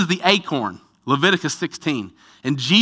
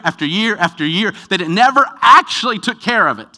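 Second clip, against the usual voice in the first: higher-pitched, emphatic preacher delivery; impassioned preaching; 6 semitones higher, 5 dB louder than usual. Real, not acted. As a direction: preach it with conviction, punching the key words. Preaches at rising full force.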